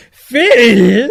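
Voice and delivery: loud, high rising call of Phil; mock echo of the call. Friend loudly echoing her imitation of his own call. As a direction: belt out Phil as a big rising question.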